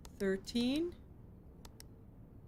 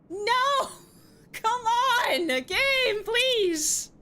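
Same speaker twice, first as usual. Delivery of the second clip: high, loud wail; whiny, almost crying protest; desperate pleading; 12 semitones higher, 6 dB louder, much slower than usual. Real, not acted. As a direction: wail and beg the game, stretching each word. Wailing at the game.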